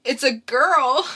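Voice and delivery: loud, high, slow cry of joy; joyful announcement. Acted. [name] announces the birth of his daughter, loud and joyful. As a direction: announce your newborn daughter. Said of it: "[name] doing the characters".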